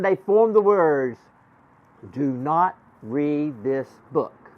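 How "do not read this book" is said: slow, word by word; slow reading-out. Acted. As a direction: spell out the warning slowly, word by word. Slowly reading out magic words.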